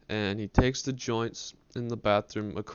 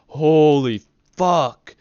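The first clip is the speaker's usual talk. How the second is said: very slow, loud, higher roar of disbelief; shocked shout; 6 semitones higher, 14 dB louder, much slower than usual. Retold or imitated. Dad staring at the bong, a loud stunned shout. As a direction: bellow it in shock. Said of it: "he says it again".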